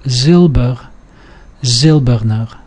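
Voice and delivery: Silber and Silberner both stressed on "Sil"; stem stress. Shown as a demonstration. Teacher showing stress follows the stem, stem stress. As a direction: keep the weight on the first syllable in Silber, Silberner. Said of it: "usually follows the stem".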